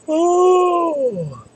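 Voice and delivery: a long high yawn on oh. Real, not acted. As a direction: let out a big yawn. Late-night yawning, drawn-out high oh.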